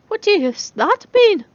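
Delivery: high, slow indignant question; sharp startled question. Acted. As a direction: ask it high and defensively. Charlie defensive, sharp question.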